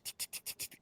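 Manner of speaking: soft unvoiced tick tick tick; whispered ticking. Shown as a demonstration. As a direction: tick tick tick like a clock. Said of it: "those little tick".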